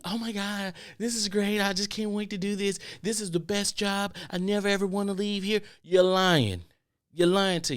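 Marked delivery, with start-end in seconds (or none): 0.1-5.6 high, gushing fake-cheerful voice; mock an overeager employee; lightly mocking fake enthusiasm; fake bubbly coworker. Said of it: "these fake bubbly".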